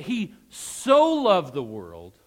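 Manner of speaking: "so" soaring high and loud; stress on so. Shown as a demonstration. Preacher contrasting God's love with theirs, huge stress on "so". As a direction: stretch "so" out at full voice. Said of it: "emphasize so in your mind".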